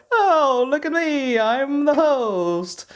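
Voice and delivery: very high, slow, showy alien host voice. Retold or imitated. Mimicking the alien talk show host, very high and slow. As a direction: squeak it out like a grand alien showman. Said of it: "the host's voice is more like".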